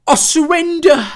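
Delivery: high, loud dramatic shout; acted surrender cry. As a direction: cry it out at the top of the voice. Dying character's cry in story.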